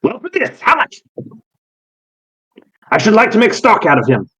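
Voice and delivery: high, fussy voice jumping up on how much; demanding a price for the dog; greedy eccentric breeder. Acted. GM voicing Callus.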